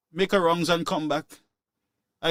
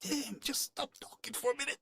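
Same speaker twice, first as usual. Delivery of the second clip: muttered aside to wife; 8 semitones higher, 14 dB quieter than usual. Retold or imitated. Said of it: "she's in the background talking".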